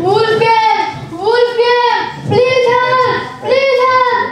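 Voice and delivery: high, acted cry of alarm; shouting for help. Bored shepherd boy falsely alerting farmers, shouting for help. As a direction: call out to the farmers, pitched high. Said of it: "he started shouting".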